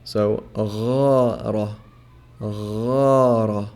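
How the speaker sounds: غار slow with a gargled ghain at the back of the throat; Arabic ghain. Shown as a demonstration. Teacher modelling غار slowly for learners, gargled ghain. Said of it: "That's the ghain".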